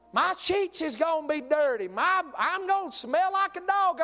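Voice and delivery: put-on high whining teen complaint; whiny daughter. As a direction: whine like a grossed-out teen. Daughter whining about the dog.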